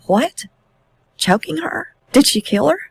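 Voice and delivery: a stunned father, asking fast and rising; shocked disbelief. Retold or imitated. A father reacting to his son's confession, shocked and rising. Father hears children were killed.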